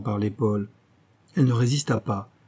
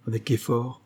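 ordinary delivery, nothing marked

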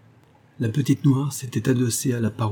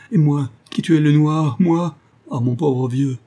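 louder, high cry of horror; anguished, raised-voice self-reproach; anguished realisation; 5 semitones higher, 5 dB louder than usual. A narrator's reading. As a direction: cry out in dawning guilt. Horror at killing the wrong man.

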